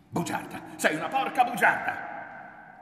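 loud insult exploding upward; high, very loud outburst; furious shout. A narrator's reading. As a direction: spit out the insult, then yell it. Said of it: "gridò lui".

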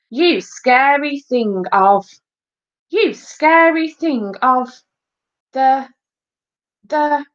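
slow, high declamation, faltering at the end; forgotten-lines stage delivery; stilted school-play acting. A narrator's reading. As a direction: recite grandly, then get stuck on the words. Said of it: "despite being loud and thunderous, was flat and emotionless".

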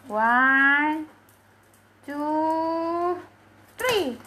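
long rising count-off; drawn-out counting. Real, not acted. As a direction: stretch each number, pitch climbing. Counting out loud for pet command. (hitungan panjang dan naik)

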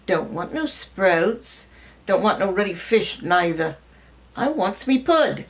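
put-on a four-year-old refusing his dinner in a Gloucestershire accent; lightly voiced Gloucestershire child, stubborn; broad Gloucestershire accent. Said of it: "in a broad Gloucestershire accent".

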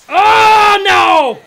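high, very loud cry of dismay; real gamer despair; dismayed shout. Real, not acted. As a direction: wail at the screen. His runner gets thrown out.

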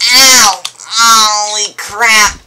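loud, high shout of pain, crap drawn out; pained yelp. Acted. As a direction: holler as if struck hard. Villain gets hit again.

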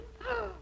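a quick quiet gasp; shocked gasp. Acted. Princess gasping on spotting her old broom. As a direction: gasp in alarm.

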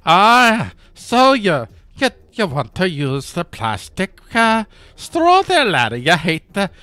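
high, hammed-up Irish authoritarian; mock Irish accent. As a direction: put on an Irish enforcer's scolding. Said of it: "authoritarian person with an Irish accent".

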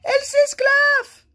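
very high, slow, loud screech; outraged roar. A narrator's reading. King protests at laughter, roaring. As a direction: roar it in horror, stretched out. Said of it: "rugit le roi".

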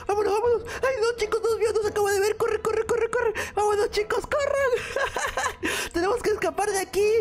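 high, frantic yelling; panicked. Acted. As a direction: run away screaming. Caught, running away. (pánico fingido)

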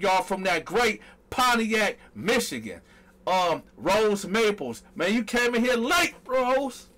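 big up each name and city, stretching place names; hype roll call. Real, not acted. Host shouting out live viewers by hometown, hyped roll call.

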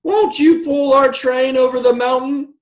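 loud, very high chorus-of-toys plea; squeaky high storybook cry. A narrator's reading. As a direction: beg at the top of a tiny high voice. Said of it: "cried all the dolls and toys".

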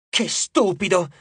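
scolding himself; annoyed at his own mistake; self-scolding; self-reproach. A narrator's reading. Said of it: "si rimproverò Leo".

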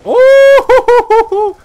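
high, loud hype squeal; thrilled gamer yelp; excited squeal. Real, not acted. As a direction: squeal at the big play. Big play in game.

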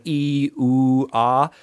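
ee, oo, ah each sung out as a pure vowel; vowel demo. Shown as a demonstration. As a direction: show the three vowels clearly one by one. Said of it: "pronounce the same vowel sounds".

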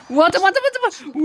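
high, loud panicked cry; scrambling in real panic. Real, not acted. Panicking in horror game.

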